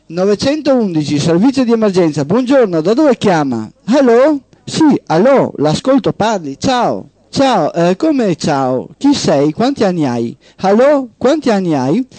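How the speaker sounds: crisp phone-desk voice, calling out allo; emergency operator. Acted. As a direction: operator answering a silent call. Emergency operator answering a call.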